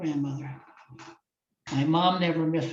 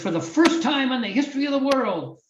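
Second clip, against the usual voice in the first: higher, louder announcer call, a touch hammed-up; imitating the announcer; 10 semitones higher, 4 dB louder than usual. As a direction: boom it out like the arena announcer. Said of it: "the announcer says".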